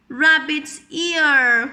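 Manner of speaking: ordinary delivery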